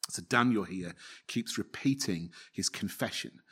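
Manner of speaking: ordinary talk, nothing marked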